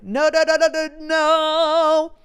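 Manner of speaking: loud, high drawn-out protest; hammed-up wailing refusal; mock wail. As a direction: wail it, holding the last word long. Reacting to a tear-jerking song.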